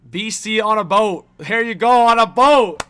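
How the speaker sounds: loud, high, hyped-up shout; rising whoop of joy; excited cheer. Real, not acted. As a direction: cheer the boat viewer on, loud. Cheering a viewer on a boat.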